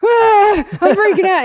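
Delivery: very high, loud dog freak-out; hammed-up panicked scream; mock panic.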